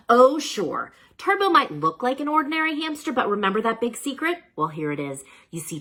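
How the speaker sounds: ordinary delivery, nothing marked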